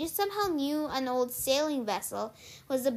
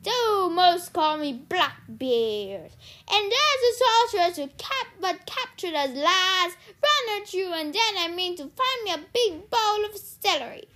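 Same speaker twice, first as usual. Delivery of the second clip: loud, raised pirate declamation; growling pirate captain; 7 semitones higher, 7 dB louder than usual. A narrator's reading. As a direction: proclaim it as a blustering pirate. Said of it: "the big man growled".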